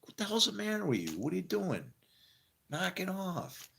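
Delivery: high, exasperated telling-off, slowing on "Knock it off"; half-joking scold of a friend who messed up; scolding a friend who broke things, mock exasperated. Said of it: "I just wanna, like".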